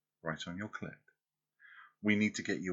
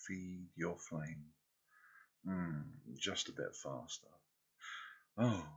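ordinary talk

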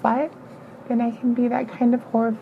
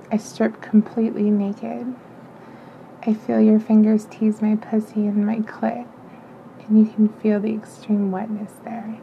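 ordinary delivery, nothing marked

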